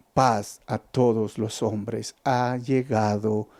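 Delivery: calm reverent proclamation; gentle peace. Retold or imitated. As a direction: speak it slowly as a gentle promise. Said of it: "Para decir".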